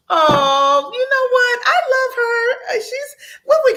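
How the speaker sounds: high, loud, tearful outburst breaking into a laugh; overcome, sobbing and laughing at once; high, crying burst of love for a friend; crying with joy. Real, not acted. Moved by the client's note.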